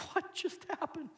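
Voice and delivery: the king can't believe his eyes; stunned king. Retold or imitated. King seeing them walk out of the fire, stunned. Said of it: "And the king was like".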